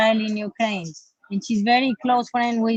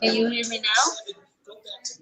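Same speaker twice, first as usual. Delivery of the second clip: bluetooth headset mic; much faster than usual. Real, not acted. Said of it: "It's, it's low".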